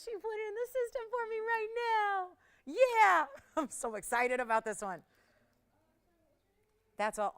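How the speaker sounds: ordinary talk, nothing marked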